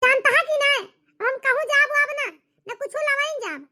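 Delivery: angry refusal. Acted. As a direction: dig in your heels and snap back at him.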